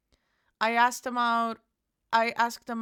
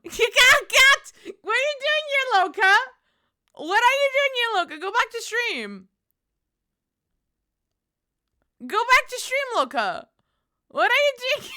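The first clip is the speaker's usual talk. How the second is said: high-pitched excited teasing; 11 semitones higher, 6 dB louder than usual. Real, not acted. Teasing a friend who dropped in.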